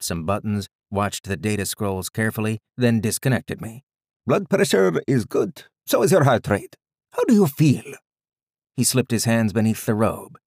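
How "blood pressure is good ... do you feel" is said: put-on high, loud Indian-accented doctor; thick Indian accent. A narrator's reading. Doctor giving readings to his patient, high thick Indian accent. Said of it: "he said in a thick Indian accent".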